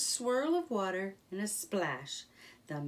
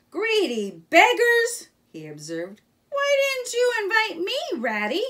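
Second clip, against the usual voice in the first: teasing Otter; 14 semitones higher, 11 dB louder than usual. Acted. Otter pops up and teases Rat and Mole, high and loud. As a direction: playfully scold the picnickers.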